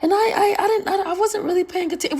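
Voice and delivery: high, flustered apologetic clerk voice with a nervous laugh; flustered saleslady. Retold or imitated. Imitating the clerk caught out and apologizing, high and flustered. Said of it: "She's like".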